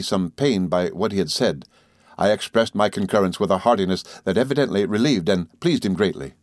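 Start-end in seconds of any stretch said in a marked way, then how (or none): none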